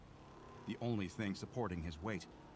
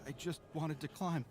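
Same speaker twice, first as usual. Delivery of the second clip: higher, teary voice; tearful plea; 6 semitones higher than usual. Acted. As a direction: plead through frozen tears. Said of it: "the tears freezing on his cheeks".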